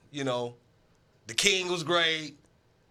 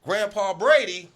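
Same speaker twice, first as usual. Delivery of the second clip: mocking call-out; 4 semitones higher, 6 dB louder than usual. Mocking Brady as old man.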